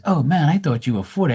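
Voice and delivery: mock surprise. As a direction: act the friend's disbelief. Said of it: "He goes".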